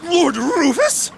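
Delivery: slow, astonished question rising at the end; can hardly believe who arrived. Acted. Officer seeing the Duke's son arrive, astonished.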